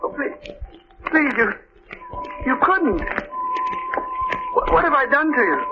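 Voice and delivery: high, panicked begging that breaks into cries; a man cornered by his murderer, crying out in terror; terrified pleading. Acted. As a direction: plead for your life as the killer closes in. Victim faces his killer.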